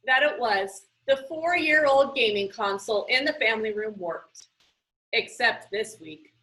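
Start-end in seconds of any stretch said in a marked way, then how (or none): none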